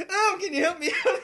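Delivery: high, loud, laughing character line; loud laughing Bomb Mom voice; laughing redo of voice. Retold or imitated. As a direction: crack up mid-line. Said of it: "I went again".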